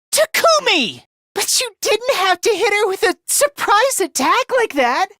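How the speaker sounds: high, upset call to a teammate; alarmed protest. Acted. Fighter objecting to Takumi's surprise attack, alarmed.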